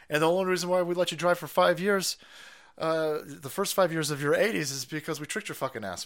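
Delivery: ordinary delivery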